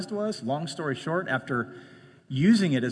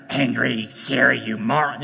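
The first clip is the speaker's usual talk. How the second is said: angry at Siri; 5 semitones lower than usual. Retold or imitated. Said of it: "I got angry at Siri".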